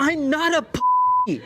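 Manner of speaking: high indignant protest. Acted. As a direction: squeak it out, offended. Indignant denial in argument.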